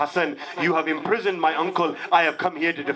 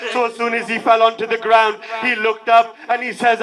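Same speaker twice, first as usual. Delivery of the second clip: higher, louder grieving preacher's cry pressing forward; impassioned lament; 6 semitones higher, 5 dB louder than usual. Acted. Preacher to mourners telling of Qasim's death, crying out in loud lament. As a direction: pour out the lament at full force to move the mourners.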